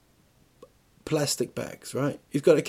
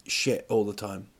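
ordinary talk, nothing marked

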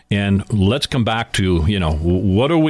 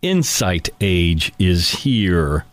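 slow dramatic reveal; 5 semitones lower, much slower than usual. Real, not acted.

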